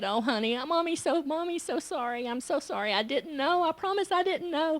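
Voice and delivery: quiet, choked-up apology to a crying daughter; mother holding her hurt child, softly tearful; tearful comforting. Retold or imitated. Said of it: "and I was crying".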